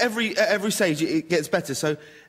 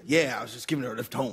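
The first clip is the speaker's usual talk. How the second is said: low, soft, fast American impression; now put on the Eddie Murphy accent; 5 semitones lower, 5 dB quieter, faster than usual. Host begs him to do the voice, low American impression. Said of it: "So do the voice".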